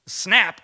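sharp loud snap; snap sound. Retold or imitated. Remembering the electric shock from his palm, sharp loud snap. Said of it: "I literally heard a snap".

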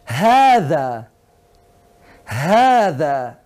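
"Hada" with a long, held first aa; long vowel. Shown as a demonstration. Teacher has student listen for vowel length, long vowel. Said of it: "Escúchalo otra vez".

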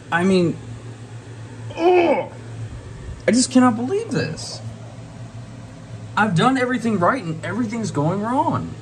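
pitch-high exasperated outburst; heated exasperation. Real, not acted. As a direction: vent the frustration at the failing plants. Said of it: "I'm just pretty damn heated".

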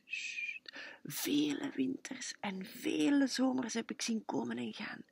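soft voiceless whisper, hushing the sheep. A narrator's reading. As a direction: breathe the words in a hush. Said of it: "dwergenwoorden fluistert hij hen toe". (fluisteren, zacht en stemloos)

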